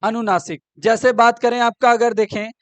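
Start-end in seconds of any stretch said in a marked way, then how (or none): none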